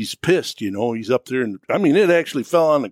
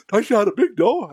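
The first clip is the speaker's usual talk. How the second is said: surprised; 9 semitones higher than usual. Retold or imitated.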